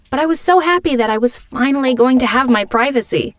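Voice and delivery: normal talking voice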